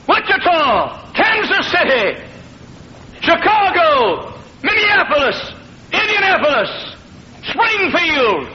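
high chanted city roll call; preaching cadence. Acted. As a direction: call out each city like a crusade. Chanted list of cities.

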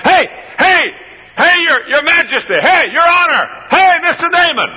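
high, breathless shouts after the chariot; panting call out. Acted. Servant chasing Naaman's chariot, breathless high calling. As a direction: call out while running hard. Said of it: "and he's panting".

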